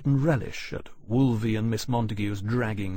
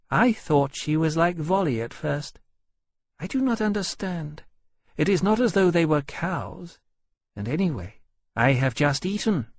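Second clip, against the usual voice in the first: lightly raised, baffled dragon voice; innocent dragon, puzzled; 6 semitones higher than usual. Acted. Dragon wonders why the guests fled, innocently puzzled. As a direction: puzzle it over earnestly.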